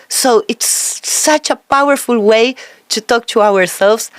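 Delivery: ordinary delivery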